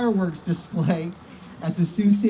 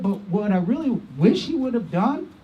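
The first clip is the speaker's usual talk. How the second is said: ordinary delivery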